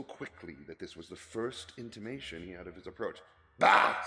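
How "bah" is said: put-on loud scornful bark of an old miser; grumpy Scrooge. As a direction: spit it out with disgust. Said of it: "said Scrooge".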